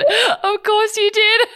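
high, loud, slow laughing squeal. Real, not acted. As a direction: crack up while saying it. Laughing at scanned brains.

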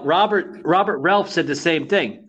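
ordinary talk, nothing marked

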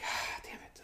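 whispered under the breath; muttered curse. Retold or imitated. Spotting her across the bar, a whispered curse. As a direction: hiss it so nobody hears.

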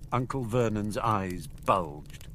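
plain reading voice

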